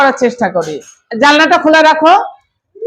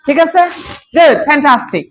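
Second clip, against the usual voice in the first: normal talking voice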